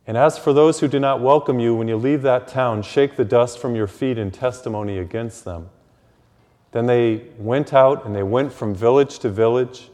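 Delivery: loud, solemn Gospel reading; Gospel proclamation. Acted. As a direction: proclaim it to the whole church.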